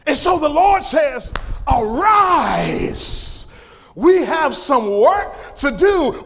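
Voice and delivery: high, rallying cry with stretched key words; impassioned preaching. Real, not acted. Preacher relaying the Lord's call to arise, impassioned preaching. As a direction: rouse the congregation to get up and work. Preaches God's command at full force.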